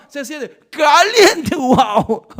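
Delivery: pretending to scald his mouth on hot tea, then a laughing wow; mock burnt mouth. Pastor acting out hot tea burning his mouth, mock yelp. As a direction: act out a burnt tongue, laughing.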